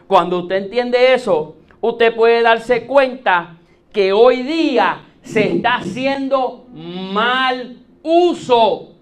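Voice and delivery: slow, loud preacher's proclamation; emphatic preaching. Real, not acted. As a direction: drive each phrase home slowly and forcefully. (prédica enfática, lenta y fuerte)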